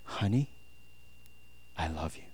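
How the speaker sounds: very soft, low sweet nothing; hushed, low declaration of love; attempted romantic murmur. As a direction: try murmuring it tenderly to a lover. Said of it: "I don't know if that's the most romantic".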